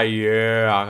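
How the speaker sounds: ordinary talk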